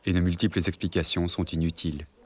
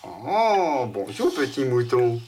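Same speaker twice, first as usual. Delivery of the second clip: high playful voice; 4 semitones higher, 4 dB louder, much slower than usual. Acted. Father plays with child.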